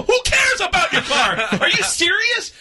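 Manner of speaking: high, exasperated mock shout; retold yelling. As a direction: yell it as in a heated retold argument. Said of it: "And he's yelling at me".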